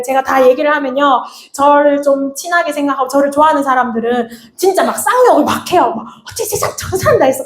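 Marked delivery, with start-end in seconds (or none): 6.3-7.4 흉내 낸 분노. Retold or imitated. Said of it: "너무너무 화를 같이 많이 내죠".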